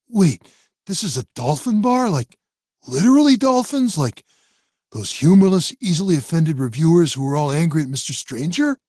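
high, loud, incredulous; can't believe what he hears; shocked disbelief. Acted. Jimmy shocked at dolphin bar.

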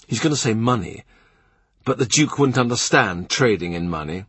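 ordinary delivery, nothing marked

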